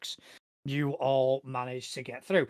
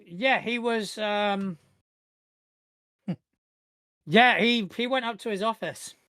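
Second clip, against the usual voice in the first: higher-voiced, hesitant guard recalling; security guard; 7 semitones higher, 4 dB louder than usual. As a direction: search for the answer as a helpful guard. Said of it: "security guard".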